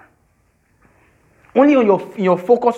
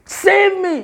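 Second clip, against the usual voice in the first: crying out; 14 semitones higher, 4 dB louder than usual. Pretend. Preacher voicing a cry for help.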